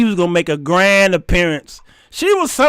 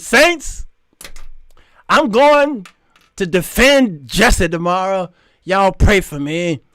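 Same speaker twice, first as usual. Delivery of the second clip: church testimony; slower than usual. Retold or imitated. Woman asking the saints for prayer, mock church testimony. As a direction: testify grandly to the congregation. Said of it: "I testified at church".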